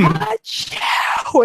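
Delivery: high, exaggerated mock sneeze; imitating a loud sneeze in a store; retold loud sneeze. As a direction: recreate the brother's huge sneeze. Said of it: "he yelled at".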